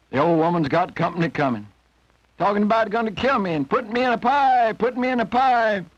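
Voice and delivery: high, strained rooster voice, fretting; worried old rooster. A narrator's reading. Rooster telling Jack he'll be cooked, high and fretful. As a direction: squeeze it up high like a scared old bird.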